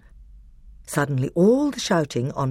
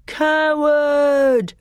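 loud, high jeering shout; loud mocking boo from the crowd; 12 semitones higher, 5 dB louder than usual. A narrator's reading. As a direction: boo him like a hostile crowd. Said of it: "booed the Telmarines".

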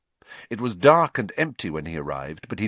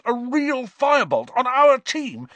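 ecstatic; 6 dB louder than usual. Acted. Wood ecstatic over firebolt.

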